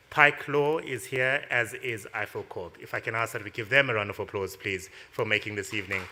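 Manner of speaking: announcer voice. Acted. MC announces sponsors and speaker.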